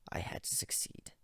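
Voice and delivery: whispering. A narrator's reading. Narrator whispering intensely.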